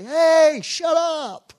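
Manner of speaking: high, loud mock yell; sisters yelling back. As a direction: shout like the annoyed sisters. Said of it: "That's what my sisters would say".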